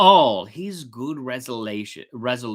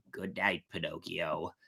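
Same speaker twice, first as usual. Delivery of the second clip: soft ghostly goodbye; 7 semitones lower, 10 dB quieter than usual. A narrator's reading. Cricket bids farewell softly.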